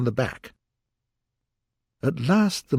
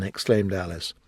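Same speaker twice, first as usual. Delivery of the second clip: ordinary delivery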